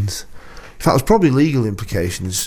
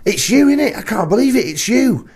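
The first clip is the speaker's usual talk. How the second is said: much higher, excited gushing at a stranger; starstruck fan; 12 semitones higher than usual. Retold or imitated. Bloke in a cafe gushing at the host, high and excited. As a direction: play a thrilled fan who thinks he has spotted a star. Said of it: "and he said".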